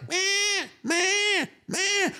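high mock baby whimper; baby crying. Imitating the crying baby on the plane, high whimpers. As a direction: whine like a fussy infant.

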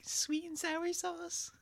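high, drawn-out comedian impression; slow, high-pitched mimicked bit; Dane Cook. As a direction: stretch it out in a high voice. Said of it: "Or Dane Cook".

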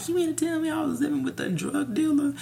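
very high, squeaky disbelief; retold incredulity. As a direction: ask it in a high voice of mock shock. Retold shock about roommate.

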